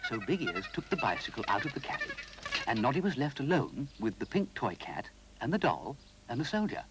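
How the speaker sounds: ordinary delivery, nothing marked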